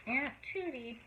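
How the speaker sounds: ordinary delivery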